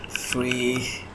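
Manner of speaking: ordinary talk, nothing marked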